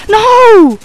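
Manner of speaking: loud, high cry of no; anguished no. Real, not acted. Player getting killed, shouts in dismay. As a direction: wail it as you get killed. Dying in game.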